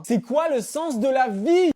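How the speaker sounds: slow, loud despairing wail; anguished shout. Acted. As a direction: cry out the question to the sky. Despairing cry on the phone.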